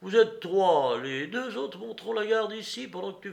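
high, loud cocky send-off; defiant, mocking woman. A narrator's reading. Woman tells Courrapier to fetch police, defiant. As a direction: call his bluff with scorn.

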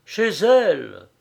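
an emphatic, agitated answer. A narrator's reading. Friend answers where he is leading him, agitated. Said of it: "très ému".

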